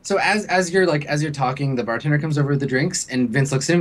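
ordinary delivery

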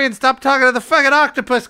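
exasperated scolding. Acted. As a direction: cut in, fed up with a crewmate. Captain scolds crewmate mid-scene.

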